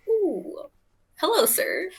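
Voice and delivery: mock swoon. Fan reacting to the movie accent, mock swoon. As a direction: coo it like you're charmed. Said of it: "the accent in the movie".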